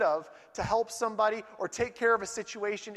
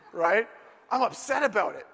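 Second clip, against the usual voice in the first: usual speaking voice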